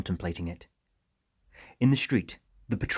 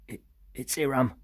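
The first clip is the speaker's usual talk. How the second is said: timid, higher-pitched hesitant answer; nervous stammer; 6 semitones higher than usual. A narrator's reading. Iram answers the squinting Harper, nervously stammering. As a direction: falter on the first word, pitch raised. Wounded man nervously gives name.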